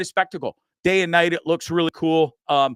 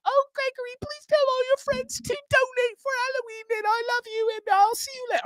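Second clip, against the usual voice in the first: much higher mock-mom sign-off; mom impression. Son imitating mom saying goodbye, much higher. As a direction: play mom asking friends to donate, pitched way up. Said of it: "I do her voice like that".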